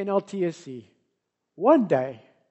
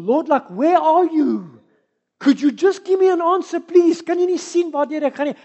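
desperate plea; 5 semitones higher, 9 dB louder than usual. Acted. Desperate prayer to God.

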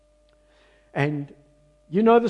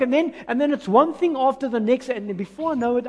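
ordinary delivery

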